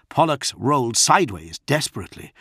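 usual speaking voice

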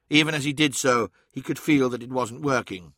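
ordinary delivery

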